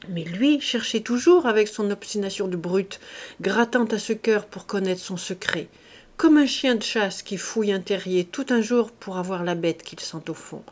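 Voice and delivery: plain reading voice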